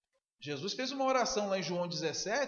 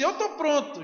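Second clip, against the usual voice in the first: higher and louder, chest out; boastful claim; 8 semitones higher, 8 dB louder than usual. Acted. Peter boasting to Jesus, loud and proud. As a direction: proclaim it proudly.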